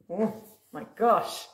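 plain reading voice

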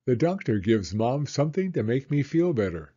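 ordinary delivery

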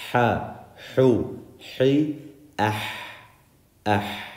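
"ha, hu, hi, ah" with a strong fogging breath on each h; breathy Arabic ha. Shown as a demonstration. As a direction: push air from the open throat on the h of "ha, hu, hi". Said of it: "That's the sound that the ha makes".

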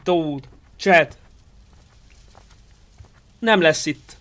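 ordinary talk

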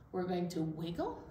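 ordinary talk, nothing marked

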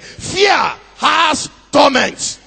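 drawn out, loud and high; slow preached shout. Real, not acted. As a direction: hammer each word to the congregation. Preacher drives point home.

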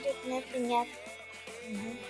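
ordinary delivery

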